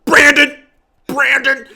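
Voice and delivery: calling him out in mock alarm; mock scolding cry of Brandon's name. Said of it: "over here being like".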